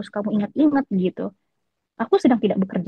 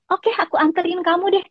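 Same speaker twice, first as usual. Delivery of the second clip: imitating his sweet tone; 8 semitones higher than usual. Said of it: "itu dia ngomong lembut sekali".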